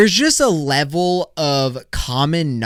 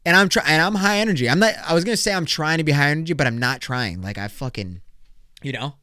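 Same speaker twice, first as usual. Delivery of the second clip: ordinary talk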